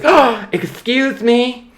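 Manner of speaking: high, slow, drawn-out indignant gasp; offended lady. Retold or imitated. Rude customer takes offence at a man in line, high and slow. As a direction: clutch your pearls, very high and slow.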